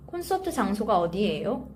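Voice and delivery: "콘서트 장소가 어디예요?" run together quickly; natural fast phrase. Shown as a demonstration. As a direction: say "콘서트 장소가 어디예요?" at fluent conversational speed. Teacher models phrase slowly, then fluently.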